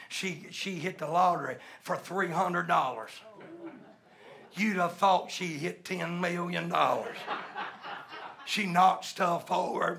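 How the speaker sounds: usual speaking voice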